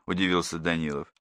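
ordinary delivery

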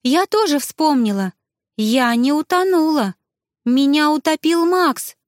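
high, agitated outburst of realization; urgent, high, slowing at the end; exclaiming. A narrator's reading. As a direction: blurt out the shocking memory. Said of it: "воскликнула Элиза".

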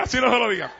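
"así no se lo diga" softened through a laugh. Shown as a demonstration. Preacher repeating the warning to the congregation, now laughing. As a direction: let a chuckle carry "así no se lo diga".

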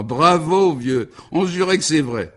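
bright, high cheer; delighted praise. Acted. Guest praising the count's costume, delighted. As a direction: cheer him on, thrilled.

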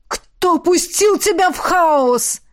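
slow, high, groaning question; anguished groan. A narrator's reading. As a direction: moan it out in dismay. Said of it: "застонал Родерик".

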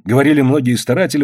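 usual speaking voice

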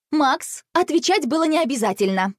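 mildly exasperated sister's retort; interrupting. A narrator's reading. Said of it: "прервала его Василиса".

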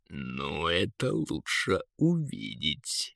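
low, soft, slow eagle; eagle's voice. A narrator's reading. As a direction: answer low and unhurried as the big bird. Eagle answers the prince. (низкий неторопливый голос орла)

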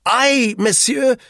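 very high, loud and slow; stern, self-important old Frenchman drawing himself up. A narrator's reading. Duclos answers his accuser, sternly. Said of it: "he said sternly".

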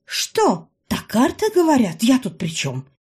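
high, loud innocent protest; feigned innocence. A narrator's reading. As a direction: play dumb innocently.

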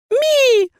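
very high, loud creature squeal; snarling little familiar. A narrator's reading. Familiar shielding the heroine, fierce squeak. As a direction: protect with a piercing little cry. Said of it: "зарычал фамильяр".

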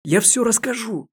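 high, loud screeching cry; shrill shriek. A narrator's reading. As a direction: shriek it out in panic. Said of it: "пронзительно завизжала".

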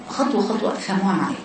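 ordinary talk, nothing marked

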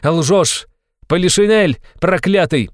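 loud, high cry of rage; furious, high-pitched outburst; uncontrolled yell. A narrator's reading. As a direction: hurl the insult at full pitch. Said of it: "завопил Раскольников, уже не удерживаясь".

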